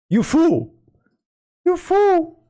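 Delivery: ordinary delivery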